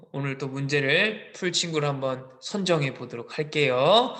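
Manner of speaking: ordinary delivery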